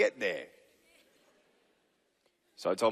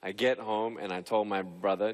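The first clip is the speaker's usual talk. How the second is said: ordinary delivery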